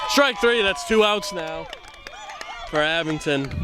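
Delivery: high-pitched, punchy play call; excited strike call. Real, not acted. Commentator calling the strikeout live, excited strike call.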